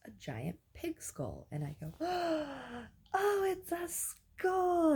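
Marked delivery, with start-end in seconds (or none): 2.0-2.9 sharp high gasp of discovery; excited gasp. Retold or imitated. Dreamer spotting a pig skull, high gasp. 3.1-4.9 much higher, slow, wide-eyed delight; excited discovery. Retold or imitated. Dreamer thrilled at a skull art find, much higher and slow. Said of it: "I get really excited".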